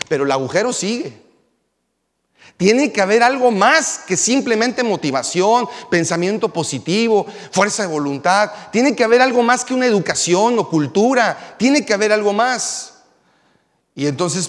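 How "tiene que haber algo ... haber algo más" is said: raised, loud preacher's climax; preaching at full force. Acted. Preacher urging the young congregation, full force. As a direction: build it up and drive it home from the pulpit.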